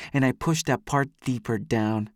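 plain reading voice

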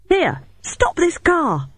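high, drawn-out shout. A narrator's reading. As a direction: shout to make the driver stop. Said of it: "I shouted".

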